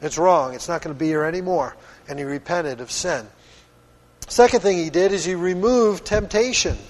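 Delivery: normal talking voice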